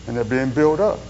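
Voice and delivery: ordinary talk, nothing marked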